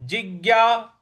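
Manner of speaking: slow जिज्ञा, syllable by syllable; careful model word; slow syllable demo. Shown as a demonstration. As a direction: say जिज्ञा slowly. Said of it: "अगला पद है".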